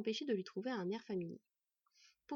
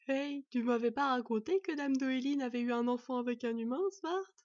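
louder, silly character voice; silly chortling; 4 semitones higher, 5 dB louder than usual. A narrator's reading. Raven teases his brother about the child, sillily. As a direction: put on a daft, chortling voice. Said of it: "gloussa niaisement Murk".

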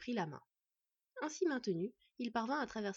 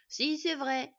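raised, louder, insistent dwarf; childish retort; 5 semitones higher, 7 dB louder, much slower than usual. Acted. Dwarf squabbling with the boy, raised and insistent. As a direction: argue back stubbornly.

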